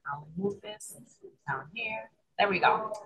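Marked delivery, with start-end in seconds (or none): none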